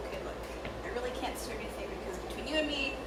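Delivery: hushed, low bartender's confession; very soft, confiding undertone; confidential aside. Acted. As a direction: drop your voice to share a secret.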